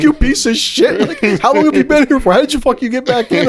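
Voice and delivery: mock angry scolding. Girlfriend scolding him after prank.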